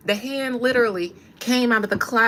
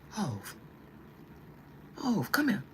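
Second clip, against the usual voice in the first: deep [name] voice; 9 dB quieter than usual. Retold or imitated. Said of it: "[name] got deep voice".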